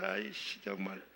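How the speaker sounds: low, soft retold grumble; grumbling aside. Husband beside his baseball-mad wife, retold grumbling. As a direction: mumble a mock complaint to yourself.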